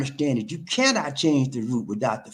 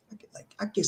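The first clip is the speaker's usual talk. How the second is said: whisper; 4 semitones higher, 9 dB quieter, faster than usual. Real, not acted. Weary aside.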